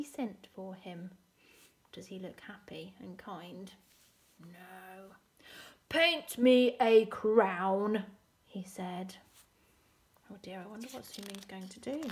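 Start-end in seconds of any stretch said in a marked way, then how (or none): 5.9-8.0 very loud, drawn-out royal order; commanding emperor. Acted. Emperor demanding a crown from Su Ming, commanding. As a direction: order it slowly and forcefully.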